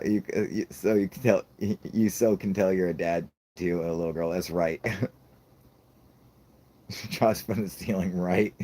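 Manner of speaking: usual speaking voice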